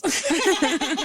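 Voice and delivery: high-pitched laugh. Real, not acted. Storyteller laughing at her own shock, amused.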